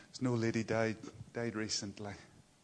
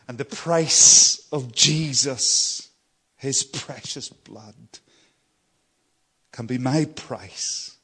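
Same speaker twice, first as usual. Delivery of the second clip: slow, much louder proclamation; full-force preaching; 14 dB louder, slower than usual. Acted. Preacher proclaiming the price of Jesus's blood, slow and loud. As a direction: drive the price home slowly.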